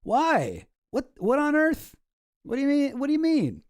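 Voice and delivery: what on earth, rising in disbelief; baffled disbelief. Retold or imitated. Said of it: "you're thinking to yourself".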